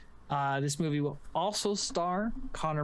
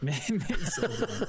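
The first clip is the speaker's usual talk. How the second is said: slow, laughing through the words; breaking up with laughter; 7 semitones higher, much slower than usual. Real, not acted. Laughing while talking.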